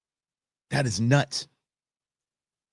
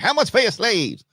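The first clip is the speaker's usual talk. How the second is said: a touch higher, mock Cartman asking bluntly; 10 semitones higher, 4 dB louder than usual. Retelling South Park, Cartman asks the athletic department. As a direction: blurt it like a bratty cartoon kid. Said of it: "He's like".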